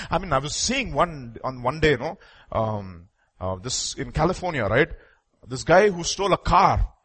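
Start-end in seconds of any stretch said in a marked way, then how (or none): none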